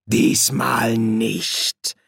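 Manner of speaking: very slow, drawn-out refusal; slow, ominous contradiction; menacing demon. Acted. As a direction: draw each word out with relish. Hastur refuses Crowley's plea.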